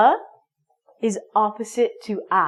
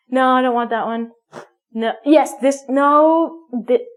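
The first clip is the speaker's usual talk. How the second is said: fussy picky chooser; 3 semitones higher, 6 dB louder than usual. Acted. Picky person rejecting tissues.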